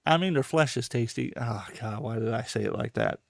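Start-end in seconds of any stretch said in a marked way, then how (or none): 1.4-3.2 much softer, lower, mumbled to himself; embarrassed mutter. Real, not acted. Embarrassed by his own remark.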